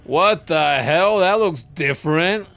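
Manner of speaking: loud startled what the hell; loud surprise. Real, not acted. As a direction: blurt it out, shocked. Startled by changed game scene.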